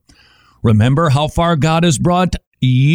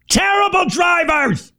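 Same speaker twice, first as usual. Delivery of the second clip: higher, strained half-shouted prayer; exasperated mock prayer. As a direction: pray through clenched patience at bad drivers. Mock prayer stuck in traffic.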